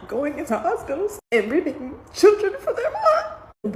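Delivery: high, slow, sobbing through the words; crying. Real, not acted. Mom imagining children taken from mothers, crying. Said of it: "I'm so sorry I did this crying".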